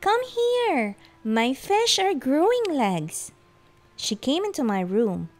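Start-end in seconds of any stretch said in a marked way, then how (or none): 0.0-3.3 high, thrilled call to come see; eager child calling out; excited yell. A narrator's reading. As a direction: summon Mom in high-pitched excitement. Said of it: "I yelled with excitement".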